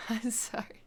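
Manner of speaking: apology whispered through laughter; breathless, laughing whisper of apology; helpless laughter. Real, not acted. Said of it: "I started laughing".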